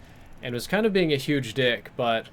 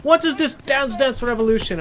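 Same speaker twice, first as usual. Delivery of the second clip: high, loud imitation of an angry Indian mother; hammed-up Indian accent, pitched way up in outrage; Indian-accented screaming; 11 semitones higher, 5 dB louder than usual. Angry mother confronts her son's friend, shrill mock Indian accent. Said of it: "she's, like, screaming mad at me".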